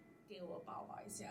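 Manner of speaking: breathy hush offering a hug; soft comforting whisper. Retold or imitated. Teacher hushing a crying girl, 轻声耳语. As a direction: soothe a crying student gently. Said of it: "I was like".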